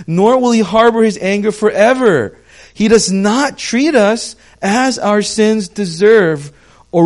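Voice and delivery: loud, high impassioned preaching; preaching at full force. Acted. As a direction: lift the room, full fervent sermon voice. Loud scripture reading.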